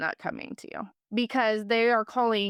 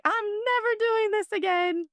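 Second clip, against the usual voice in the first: much higher put-on voice, swearing off for good; dramatic vow; 13 semitones higher than usual. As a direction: squeak it out like a heartbroken vow. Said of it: "And I was like".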